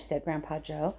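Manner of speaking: ordinary delivery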